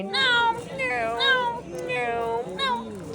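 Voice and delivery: very high, squeaky gnome call; high-pitched gnome voice. Shown as a demonstration. Gnome greeting everyone it passes, in a very high-pitched voice. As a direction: repeat it like a tiny gnome, way up high. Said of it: "talk in a very high-pitched voice".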